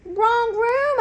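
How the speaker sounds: high, loud urgent squeak; squeaky hamster. A narrator's reading. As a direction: squeak the warning again. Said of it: "I squeaked".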